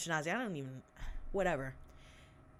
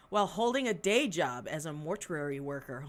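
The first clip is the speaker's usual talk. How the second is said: ordinary delivery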